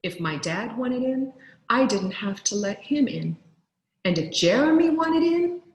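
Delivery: ordinary delivery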